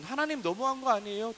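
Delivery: acted indignant complaint, high and loud; resentful protest. Imagined widow protesting to God, resentful. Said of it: "원망할 수".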